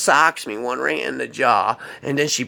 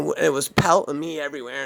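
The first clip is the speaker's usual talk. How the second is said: ordinary talk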